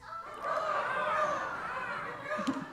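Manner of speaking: high mocking bird cackle; crow cackle. Crow mocking Harold's trade, high cackling laugh. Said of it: "the crow replies".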